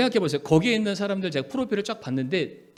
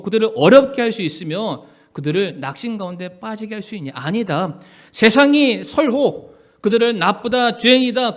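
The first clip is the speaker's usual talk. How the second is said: loud, higher, driving sermon delivery; full-force preaching; 4 semitones higher, 7 dB louder than usual. Real, not acted. Pastor assuring the congregation God defends his people, full-force preaching. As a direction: press each phrase home forcefully.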